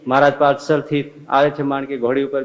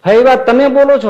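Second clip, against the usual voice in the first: high, loud disbelieving question; shocked she dares refuse; astonished challenge; 10 semitones higher, 8 dB louder than usual. Acted. Maharaj astonished at Faiba's refusal.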